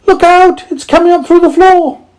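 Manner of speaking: very high, loud, quick squeaky shout; mouse shouting. A narrator's reading. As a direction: yell a warning as a tiny mouse. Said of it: "shouted one of the mice".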